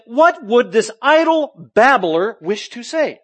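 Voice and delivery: slow, scornful quote of the philosophers; slow disdainful mockery of a babbler; mocking sneer. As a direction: drag it out with contempt. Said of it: "some were saying".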